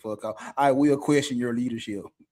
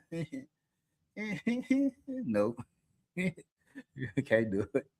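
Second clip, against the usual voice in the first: ordinary delivery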